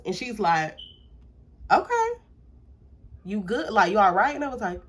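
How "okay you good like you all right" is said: a startled mom checking on her daughter; concerned surprise. Retold or imitated.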